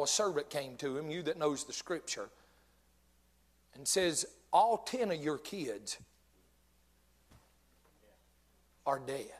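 low and soft, pausing before the last word; hushed grave retelling. Retold or imitated. Preacher retelling the servant's message to Job, hushed and grave. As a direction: let the terrible news sink in quietly.

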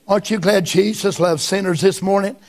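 usual speaking voice

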